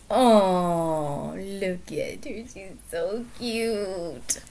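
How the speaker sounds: slow, fond coo; cooing over cat. Real, not acted. Owner cooing at her cat Pancake, slow and fond. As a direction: melt over a cute pet.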